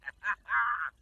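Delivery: villain laugh. Acted. Glitch barging to the front.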